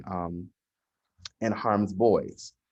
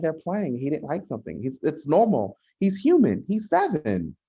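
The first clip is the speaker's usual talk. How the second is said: indignant defense; 7 semitones higher than usual. Retold or imitated. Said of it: "I'm like".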